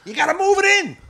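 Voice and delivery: high, loud burst; ranting at bad parkers; exasperated yell. Real, not acted. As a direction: really yell the order. Ranting about drivers.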